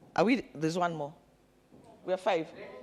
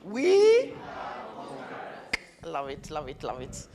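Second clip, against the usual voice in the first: playful demo; 4 semitones lower, slower than usual. Shown as a demonstration. Leader demonstrating the English phrase playfully. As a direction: sing it out then let it fade. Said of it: "then say it in English".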